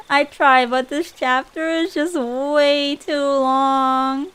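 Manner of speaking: high, loud, drawn-out whine; laughing complaint. Real, not acted. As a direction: stretch the words out in comic despair. Complains the chapter never ends.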